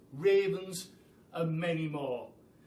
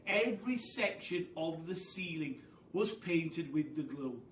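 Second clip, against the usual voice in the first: ordinary delivery, nothing marked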